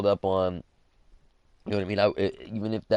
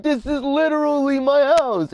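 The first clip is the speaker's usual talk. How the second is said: loud, high retold protest; exasperated protest; 6 dB louder than usual. Retelling how he told the cop it's his house, exasperated. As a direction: protest loudly, re-enacting your disbelief. Protesting to officer at own door.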